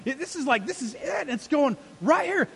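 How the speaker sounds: high, loud, impassioned preaching; full-force pulpit preaching; fiery preaching. Real, not acted. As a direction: fire up the room.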